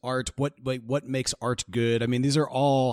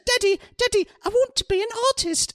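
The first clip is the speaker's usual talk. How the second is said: put-on squeaky high child's voice begging his father; a small boy pleading to be an artist; pleading little boy; 3 dB louder than usual. Host voices young Monet begging his father, high childish plea. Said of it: "with a French accent".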